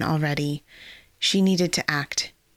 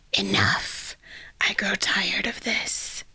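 whispered villain voice; 2 semitones higher, 3 dB quieter, slower than usual. Acted. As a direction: hiss it like an undead lich. Lich villain speaks menacingly.

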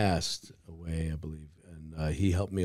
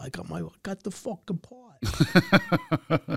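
higher, slow ecstatic cry; excited actor; 11 semitones higher, slower than usual. Retold or imitated. Actor voicing a thrilled friend getting cast. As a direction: celebrate landing the role. Actor retelling Rappaport's joy.

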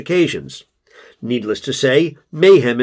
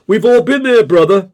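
loud agreement; 9 semitones higher, 7 dB louder than usual. A narrator's reading. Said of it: "as if to say".

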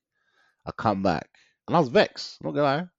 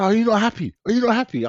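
high, pleading mock yell at him; hammed-up panic, pitch jumping up; mock frantic coach; 10 semitones higher, faster than usual. Coach mock-yells at her silent client, high and frantic. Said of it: "She's screaming, losing her goddamn mind".

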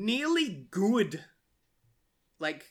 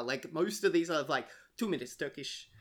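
normal talking voice

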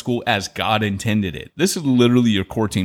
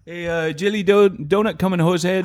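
hammed-up Canadian accent, pitched up; mock Canadian accent; 8 semitones higher than usual. Pastor jokingly talking to the congregation in a hammed-up Canadian accent. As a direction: play a jokey Canadian pastor with a raised voice. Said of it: "a Talk Like a Canadian Day".

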